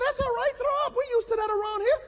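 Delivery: high put-on coach voice; trainer's voice. Trainer brushing off the pastor's nausea, high-voiced. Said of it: "He said".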